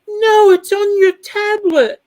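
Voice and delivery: high, loud, drawn-out yell; hammed-up panicked protest, slow and high. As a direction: imitate someone frantically insisting. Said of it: "Did you see [name] freak out".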